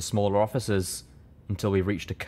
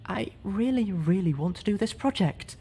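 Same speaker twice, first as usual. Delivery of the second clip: struggling for breath but pleading; weakened sad voice; 10 semitones higher than usual. Acted. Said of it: "her voice getting weaker as she struggled to breathe".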